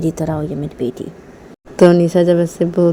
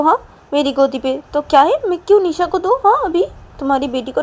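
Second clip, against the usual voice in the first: papa teasing refusal; 8 semitones higher than usual. Acted.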